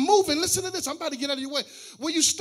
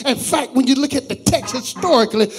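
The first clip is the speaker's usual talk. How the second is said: preaching at full force; 13 dB louder than usual. Acted. Pastor preaching loudly about Job's faith and Satan's limits. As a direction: preach it to the whole church at full volume.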